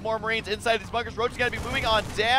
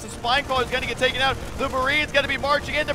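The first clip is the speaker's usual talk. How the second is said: usual speaking voice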